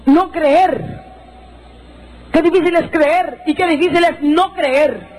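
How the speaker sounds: high, stretched-out proclamation; emphatic preaching. Real, not acted. Preacher to congregation on not believing, emphatic proclamation. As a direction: proclaim it slowly, voice lifted high.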